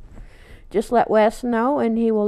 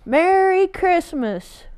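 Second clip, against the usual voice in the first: high, slow, drawn-out Merry Christmas; cheery greeting; 8 semitones higher, slower than usual. Acted. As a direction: sing it out like a happy farewell. Closing holiday greeting.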